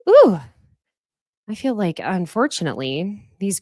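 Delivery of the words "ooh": high, loud delighted ooh; ooh reaction. Real, not acted. Host reacting to Gabby's shade, loud delighted ooh. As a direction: savor the burn with a gleeful ooh.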